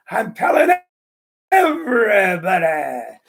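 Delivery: lightly voiced old man, slow on everybody; punchline savoured. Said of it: "comedian repeats his punchline".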